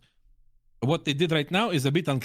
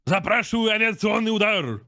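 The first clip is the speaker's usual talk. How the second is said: higher, lightly imitated commander call; commander's radio request; 7 semitones higher, 4 dB louder than usual. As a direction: echo the game commander requesting a strike. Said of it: "and he says".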